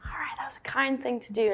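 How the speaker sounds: quick unvoiced whisper; whispered self-talk. Meditator praising themselves silently, quick whisper. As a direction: whisper a pat on your own back.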